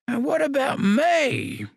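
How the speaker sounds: high, slow piping call; high piping old man's voice; shrill piping old man. Acted. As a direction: pipe up like a frail old man wanting notice. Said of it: "came a shrill, piping voice".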